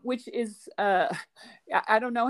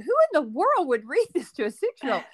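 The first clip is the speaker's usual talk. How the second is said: incredulous thought; 5 semitones higher, 4 dB louder than usual. Retold or imitated. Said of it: "And I thought".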